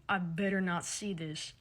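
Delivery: slow, low, soft imitation of a threat; mocking dominant drawl; mock threatening. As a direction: draw it out with fake menace. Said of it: "threatening and dominant after saying that".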